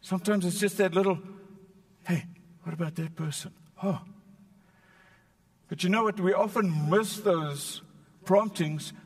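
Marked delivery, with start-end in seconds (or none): 2.0-4.0 quiet inner voice. Pretend. Inner prompting voice.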